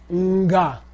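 NGA with a little N hum before the G; prenasal. Shown as a demonstration. Teacher models sound for class, prenasal nga. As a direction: lead into "nga" with a short nasal sound. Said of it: "A little nasal sound before the sound".

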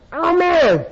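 the teacher echoing the monk's surprise, hammed-up amazed; amazed exclamation. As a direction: outdo the first cry of wonder. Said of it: "the other guy goes".

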